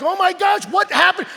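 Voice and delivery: high, loud shocked exclamation; freaking out. Acted. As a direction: exclaim it as a stunned onlooker. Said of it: "They're freaking out".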